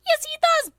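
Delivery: put-on very high, loud insistent girl voice; Miku voice. Miku insisting to Len, very high and loud. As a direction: squeal back the protest. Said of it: "This is a voice acting stream".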